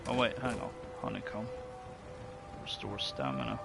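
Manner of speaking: normal talking voice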